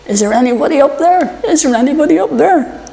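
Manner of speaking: frantic high cry toward heaven; desperate call. Acted. Man dangling from a cliff, calling to heaven desperately. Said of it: "he calls out".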